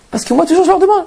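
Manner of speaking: excited rise. Real, not acted. Excited build to quoting.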